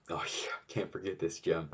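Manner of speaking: soft breathy aside to yourself; whispered aside. Real, not acted. Aside recalling a clip.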